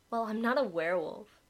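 a creature's playful jab; teasing. Acted. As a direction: tease him lightly. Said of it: "Hailstone replied teasingly".

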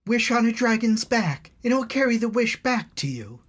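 put-on high, urgent young girl; pleading girl. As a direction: beg him to fly up there. Elna insists on wish.

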